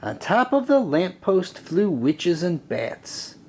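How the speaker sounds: ordinary delivery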